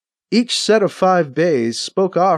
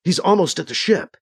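ordinary delivery

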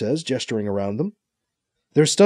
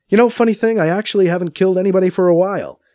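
higher, louder protest; indignant objection, pitched up; 6 semitones higher, 5 dB louder, faster than usual. A narrator's reading. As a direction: push back defensively. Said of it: "B C protests".

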